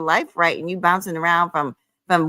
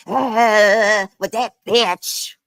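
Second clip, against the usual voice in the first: mock yelling man; 2 semitones higher, much slower than usual. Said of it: "he came up here yelling".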